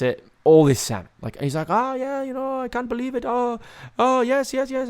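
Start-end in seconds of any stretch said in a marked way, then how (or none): 1.7-4.9 high, delighted grandpa impression; hammed-up old man overcome with delight; overjoyed old pop. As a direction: rejoice in a high put-on voice. Imitates pop delighted over the salmon.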